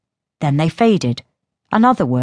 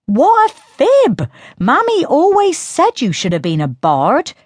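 mocking girl; 10 semitones higher than usual. A narrator's reading. Sister scoffing at Diello's story, high and mocking. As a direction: scoff at him like a bratty sister.

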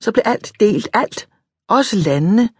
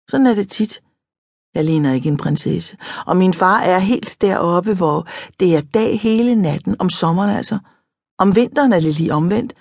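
usual speaking voice

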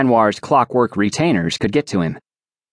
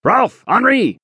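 shouted orders; 12 semitones higher than usual. Acted. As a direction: bark orders over the docks.

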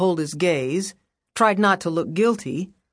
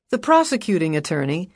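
plain reading voice

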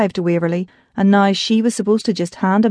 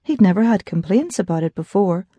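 ordinary delivery

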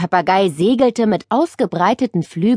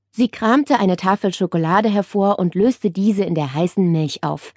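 ordinary delivery, nothing marked

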